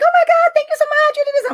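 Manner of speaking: high, squealing thank-you; excited thanks. Retold or imitated. Wife thanking husband for a gift, squealing high. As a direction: gush like a thrilled wife.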